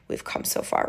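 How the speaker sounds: flüsternd wiederholt; whispered repeat. Real, not acted. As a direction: breathe the words out, no voice. Repeated line, now whispered.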